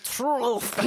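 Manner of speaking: imitating a garbled French "Truth"; "Truth" mangled and drawn out in mock French; exaggerated, throaty French accent; mock choking French accent. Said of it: "It sounded like you were joking".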